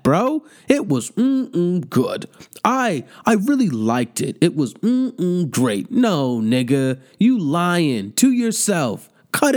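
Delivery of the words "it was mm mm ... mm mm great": slow mocking relish, drawn out; mock savoring again. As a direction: stretch the mm-mm to ridicule him. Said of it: "who talks about pussy like that".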